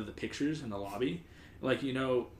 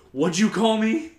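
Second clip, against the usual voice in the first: loud, high outraged yell; furious confrontation; 10 semitones higher, 13 dB louder than usual. Retold or imitated. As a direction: whirl round and shout the challenge, acting out a furious coworker. Door slammed, furious driver confronts coworker.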